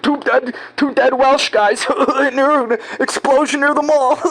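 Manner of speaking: mock cop barely holding in a laugh; cop stifling laughter. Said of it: "He's like".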